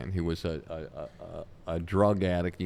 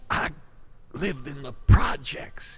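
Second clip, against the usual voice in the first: higher, slow raspy storyteller impression; raspy voice of Charlie; 7 semitones higher, 5 dB louder than usual. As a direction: rasp it out like an old street comic. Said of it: "have this raspy voice".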